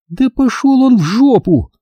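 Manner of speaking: high, slow blunt dismissal; blunt woman. A narrator's reading. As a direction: dismiss him bluntly, drawn out. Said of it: "Просто и понятно заявила Катя".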